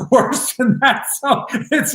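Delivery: high, slow laugh through the words; laughing it off, voice rising high. Real, not acted. Father laughs admitting he did far worse. Laughing at his own confession.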